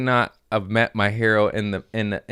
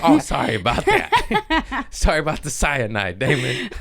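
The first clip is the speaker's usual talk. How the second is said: laughing mock apology; 4 semitones higher than usual. Comic jokingly apologizing to his hero, laughing.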